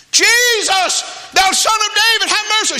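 very high, full-voiced desperate cry. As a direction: yell the plea over the crowd. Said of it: "He got louder".

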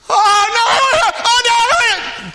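high, loud mock wail of excited children; excited kids. Preacher mimicking kids dragged down the boardwalk, high and loud. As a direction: whine like a kid pulled past the treats. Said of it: "those kids are gonna be like".

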